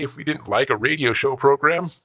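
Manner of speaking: normal talking voice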